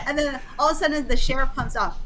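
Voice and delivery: ordinary talk, nothing marked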